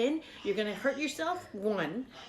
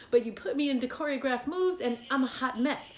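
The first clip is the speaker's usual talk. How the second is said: ordinary talk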